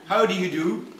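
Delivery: housemaster's formal hello; formal McGuire. Acted. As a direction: precise and cold. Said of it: "very formal".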